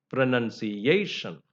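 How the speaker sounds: pronunciation with a drawn-out stressed A syllable. Shown as a demonstration. Teacher shows where primary stress falls, stress on A in pronunciation. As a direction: lean slowly on the A in pronunciation. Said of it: "the stress falls on".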